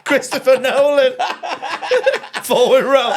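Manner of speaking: high, loud words through real laughter; helpless laughter. Real, not acted. Cracking up at co-host's mixed-up director name, laughing hard. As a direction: crack up while getting the words out.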